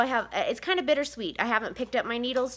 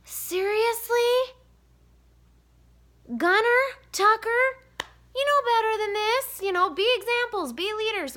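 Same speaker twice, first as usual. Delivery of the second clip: scolding; 11 semitones higher, 4 dB louder than usual. Retold or imitated. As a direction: stretch out the disbelief, then lecture. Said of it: "and I'm just like".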